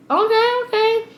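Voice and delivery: high-pitched put-on okay; squeaky little crowd voice; mock crowd reply. Voicing the people's sceptical reply.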